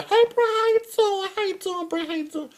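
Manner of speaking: high falsetto cheery drive-through greeting; mock greeting voice. Mimicking the friendly worker, high falsetto. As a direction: chirp it like the McDonald's worker.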